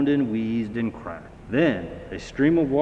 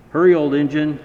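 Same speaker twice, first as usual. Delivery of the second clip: loud, higher urging cry; urging call; 5 semitones higher, 10 dB louder, faster than usual. A narrator's reading. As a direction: spur the old engine on. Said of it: "The wheels seemed to rumble".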